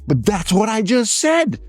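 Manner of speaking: high, slow protest; acted indignant complaint; mock exasperated. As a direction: argue back in a high, drawn-out voice. Retold argument with his wife.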